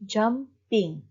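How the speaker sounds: "jumping" as jum-ping with a pause between; syllable by syllable. Shown as a demonstration. Teacher shows two syllables, "jumping" split in two. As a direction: cut "jumping" into two separate beats. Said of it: "Two syllables".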